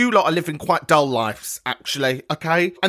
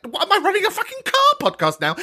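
exasperated; 13 semitones higher, faster than usual. Real, not acted. Exasperated rant.

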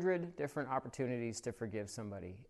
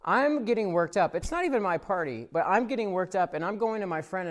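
ordinary talk

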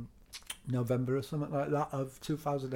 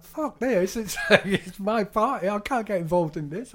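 high, loud, flustered protest; much higher and louder, half-laughing panic; mock panic; 8 semitones higher, 6 dB louder than usual. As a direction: act out a boss refusing to get involved. Retold panicked boss at party.

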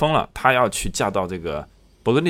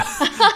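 sudden high-pitched laugh; a short squeal of laughter; high burst of laughter; 5 dB louder than usual. Real, not acted.

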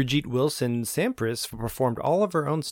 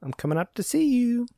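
character voice; 13 semitones higher than usual. Pretend. Voicing character on screen.

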